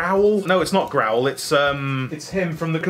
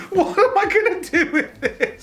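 laughing disbelief; 12 semitones higher than usual. Real, not acted. Baffled by a box of junk.

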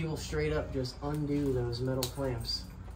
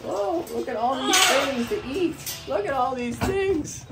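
high, loud delighted whoa; high excited gush to the birds about their food; 12 semitones higher, 6 dB louder than usual. Real, not acted. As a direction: gush over the pile of treats. Excited at bird toys.

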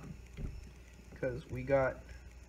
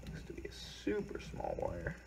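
whisper; 4 semitones higher, 8 dB quieter than usual. Real, not acted. Quiet aside while working.

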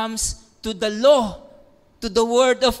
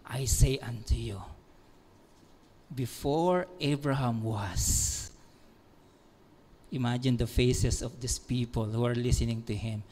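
much lower, much softer reverent hush; hushed solemn; 9 semitones lower, 8 dB quieter than usual. Acted. Preacher quoting Jesus to the crowd in a solemn hush.

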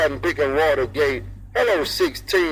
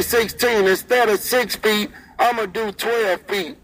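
ordinary delivery

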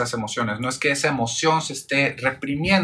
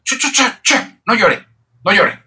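much higher and louder, imitating a caregiver shushing a crying child; mock hushing caregiver; 8 semitones higher, 5 dB louder than usual. Caregiver hushing a crying child, high mock shushing. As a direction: hush the child like an anxious parent, pitched way up. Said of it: "¿qué es lo que suena?".

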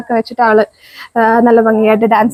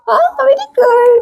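delighted praise; 10 semitones higher, much slower than usual. Real, not acted. Mother praising her toddler for fetching, delighted. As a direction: stretch the praise out high and slow.

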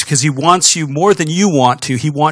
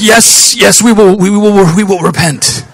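high, loud, eager yes we will; frantic agreement; 5 semitones higher, 6 dB louder, slower than usual. Retold or imitated. Preacher voices terrified Ninevites eagerly promising to repent, loud and high.

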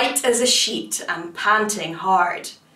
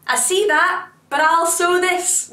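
higher-pitched, busy tailor's retort; undaunted tailor; 7 semitones higher than usual. A narrator's reading. Tailor answers the rising monster while sewing, undaunted. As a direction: pipe up, determined to keep working.

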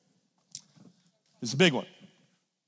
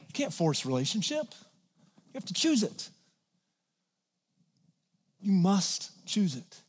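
soft earnest; 7 dB quieter than usual. Real, not acted.

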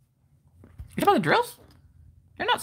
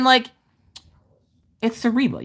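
ordinary talk

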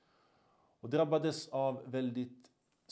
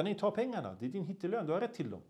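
insisting; 7 semitones higher, faster than usual. Retold or imitated. Man insists, back and forth.

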